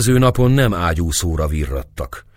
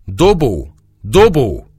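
higher, louder desperate call; 6 semitones higher, 6 dB louder, slower than usual. A narrator's reading. Turkish mother calling for Dobó, loud cry. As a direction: call for Dobó across the ruins. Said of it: "hát csak ezt a szót kiáltozta".

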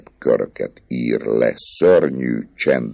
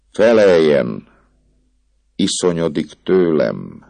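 hangos, magasabb, erőszakos felszólítás; a pap rákiabál, választ követel; 6 semitones higher, 5 dB louder than usual. A narrator's reading.

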